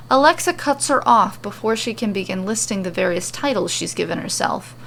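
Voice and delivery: ordinary delivery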